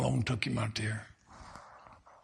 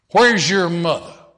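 loud, pointed question; forceful question; 4 semitones higher, 12 dB louder than usual. Retold or imitated. Preacher confronting the old man, loud pointed question. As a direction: hit him with it hard.

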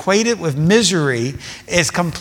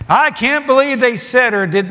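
a hint of an indignant churchgoer, raised and huffy; mock outrage; 7 semitones higher than usual. Preacher mimics a church member fuming over a believer, mock outrage. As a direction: lightly imitate a scandalised believer.

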